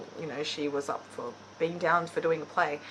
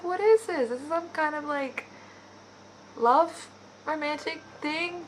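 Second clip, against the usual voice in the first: high, baffled retelling of her reaction; incredulous; 9 semitones higher, 4 dB louder than usual. Retold or imitated. Vlogger recalling her reaction to a sappy scene, incredulous. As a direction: question it in mock disbelief, pitched up.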